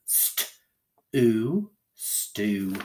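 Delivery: "stew" in pieces, with a held oo vowel; long U. Shown as a demonstration. Teacher reading a first syllable, long oo in "stew". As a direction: sound out "St, oo", then blend "stew". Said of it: "read this one with long U".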